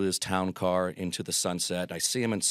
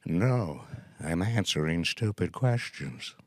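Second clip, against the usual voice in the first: put-on a light old Groucho, slow and dry; deadpan it slowly, an old comic's put-down; slow Groucho reply; slower than usual. Groucho answering a fan's question, slow dry deadpan. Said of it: "He looked up slowly and said".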